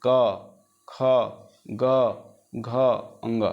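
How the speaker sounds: क ख ग घ sounded one by one from the throat; teacher sounding out throat consonants; throat sounds demo. Shown as a demonstration. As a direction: model each throat consonant clearly. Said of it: "घेचा से का कहे?".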